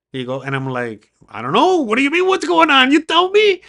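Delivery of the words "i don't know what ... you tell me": much higher and louder, incredulous; retold exasperated yell. Arrested driver snapping back at the sheriff, exasperated shout. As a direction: throw the question back at him.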